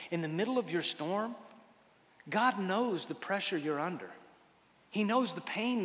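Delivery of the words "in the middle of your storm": ordinary talk, nothing marked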